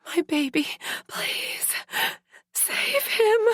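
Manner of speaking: breaking, high tearful begging; injured mother pleading through tears; crying plea. A narrator's reading. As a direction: sob out the plea for her baby. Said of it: "I cried against the pain".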